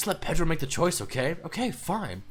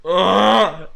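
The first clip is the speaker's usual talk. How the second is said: loud, high ugh of disgust; frustrated groan; 6 semitones higher, 9 dB louder than usual. Acted. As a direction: let the disgust burst out. [name]'s outburst mid-rant.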